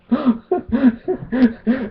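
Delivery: high-pitched run of giggles; real laugh. Real, not acted. Vlogger amused by his opponent's accusations, real laughter. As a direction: laugh helplessly at what you just read.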